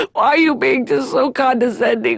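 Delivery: tearful, wounded protest; hammed-up weepy complaint; crying. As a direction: accuse them through sobs. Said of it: "When I cry really hard".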